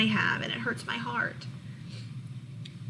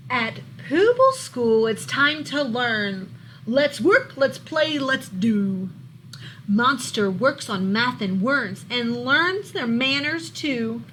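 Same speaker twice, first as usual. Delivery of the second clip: lively, louder storybook read-aloud; reading to children; 3 semitones higher, 5 dB louder, slower than usual. A narrator's reading. Teacher reading a monster book to her class, lively storybook voice. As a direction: bring the picture book alive for the class.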